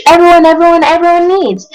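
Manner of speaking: emphatic boast. A narrator's reading. Onceler boasting, repeating the word.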